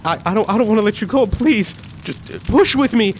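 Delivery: high, fast, desperate begging; pleading through tears. Acted. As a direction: beg him not to give up. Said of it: "I'm like".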